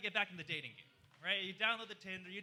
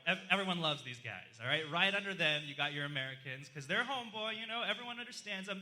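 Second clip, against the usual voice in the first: ordinary talk, nothing marked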